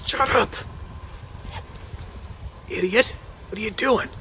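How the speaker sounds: high, quiet mock telling-off; hushed scolding. Guide to hunter who spoke up near the bear, hushed scolding.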